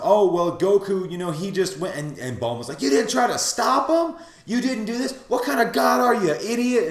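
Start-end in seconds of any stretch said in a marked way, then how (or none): 0.0-1.9 high, loud flustered excuse; mock someone scrambling to defend himself; Beerus, defensive. Beerus defending himself to Bulma, flustered. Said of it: "tries to defend himself". 2.8-6.9 loud, high-pitched mock berating; Bulma, laying into him. Bulma lays into Beerus for not stopping Goku, berating. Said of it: "just starts laying into him".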